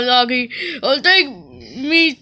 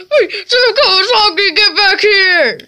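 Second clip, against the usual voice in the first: laughing call; 6 semitones higher, 4 dB louder than usual. Acted. Calling after fleeing chef.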